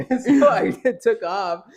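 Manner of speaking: laughing through the words; breaking into laughter. Real, not acted. Laughing while telling ghost story.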